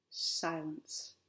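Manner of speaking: soft, low single word after the calling; quiet, suspenseful storyteller; hushed. A narrator's reading. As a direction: drop right down into a hush. Hush after the unanswered call.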